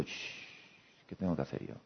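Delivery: whispered shh, then a low murmured question; hushed inner voice. Pretend. Telling oneself to hush and look inward, whispered. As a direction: hush yourself and ask under your breath.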